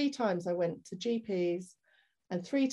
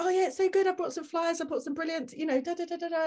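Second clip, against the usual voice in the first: put-on kids' TV presenter; 9 semitones higher, faster than usual. New mum faking cheer to the health visitor, high and bubbly. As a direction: put on a big bright act of being fine. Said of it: "I was like a C B B presenter myself".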